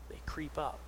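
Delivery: hushed, secretive storytelling whisper; whispered narration. Retold or imitated. Preacher telling how the men creep up, whispered.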